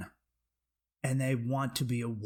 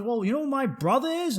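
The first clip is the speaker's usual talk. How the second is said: raised-pitch mock bragging question; imitated boaster; 10 semitones higher, 3 dB louder than usual. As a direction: play the well-connected stranger, higher. Acted man boasting.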